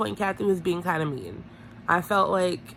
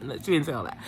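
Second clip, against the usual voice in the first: ordinary delivery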